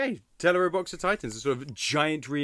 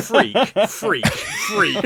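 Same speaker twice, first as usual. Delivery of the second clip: glasses voice; 6 semitones higher, 8 dB louder, much slower than usual. Retold or imitated. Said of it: "it just goes".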